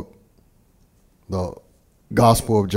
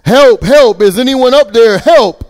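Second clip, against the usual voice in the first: high, loud cries for help from a cliff; man hanging from a branch calling for rescue; yelling for help; 10 semitones higher, 8 dB louder than usual. Acted. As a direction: shout up the canyon, desperate. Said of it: "So Jack began yelling for help".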